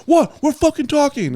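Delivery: high-pitched indignant squawk at an intruder; mock outrage. Acts out woman turning on intervener.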